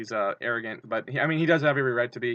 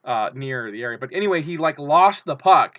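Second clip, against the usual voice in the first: ordinary delivery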